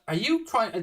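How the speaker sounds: ordinary talk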